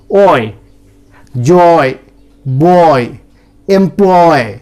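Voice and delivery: oy sound drills; oy diphthong demo. Shown as a demonstration. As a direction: glide each word from o into y. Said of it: "So these are all oy sound".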